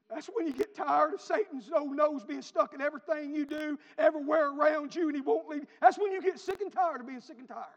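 loud, high, fired-up preacher; full-force preaching. Real, not acted. Preacher to church, fed up with Satan, shouted preaching. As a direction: drive it at the congregation in a sustained shout.

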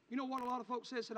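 ordinary talk, nothing marked

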